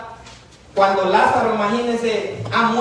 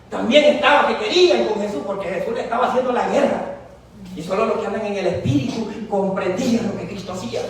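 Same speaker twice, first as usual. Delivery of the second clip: normal talking voice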